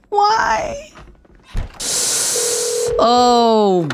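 high laughing wail; laugh-cry in disbelief; laughing despair. Real, not acted.

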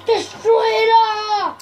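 slow, drawn-out destruction cry; playful rampage shout; mock villain shout. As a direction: holler it with hammed-up relish. Destroyer's cry, first take.

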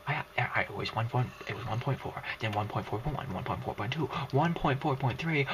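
ordinary talk, nothing marked

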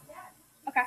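normal talking voice

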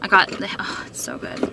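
hushed, pleased whisper; whispered delight. Real, not acted. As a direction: breathe the words out in a whisper. Whispering over the coffee.

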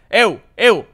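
loud shout to wake a sleeping friend; loud shout, half-mock; yelling to wake. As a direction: yell him awake, loud. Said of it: "le empiezo a gritar".

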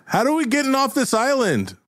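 high-pitched urgent demand; heckling crew member. Acted. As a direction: call it out high from the crowd. Crew member interrupts the speech.

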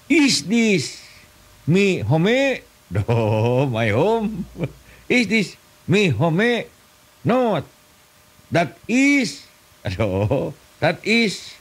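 high, slow broken English; mock English drill. Comedian doing a silly English lesson, high slow broken English. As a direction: drill it like a clumsy schoolbook dialogue.

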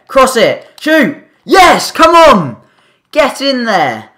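high, loud goal celebration; excited shouting. Real, not acted. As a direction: yell it in as the ball goes in. Scoring a goal in FIFA.

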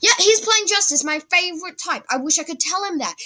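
bright, lifted excited voice; thrilled to see a fan. Real, not acted. Excited about opponent's deck.

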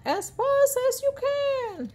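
very high, stretched urging; high rallying cry. A narrator's reading. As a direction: urge everyone to flee. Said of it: "cried Grand Dude".

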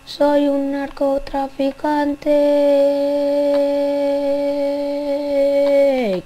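very slow, stretched, put-on voice; drawn-out dealer voice. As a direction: drag every syllable like a shady dealer. Playing a drug dealer role. (voz de camello muy lenta y arrastrada)

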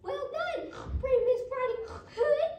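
high, loud cheer; pig's yell. Acted. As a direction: yell congratulations in a squeaky pig voice. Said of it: "and yelled".

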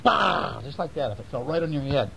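ordinary delivery

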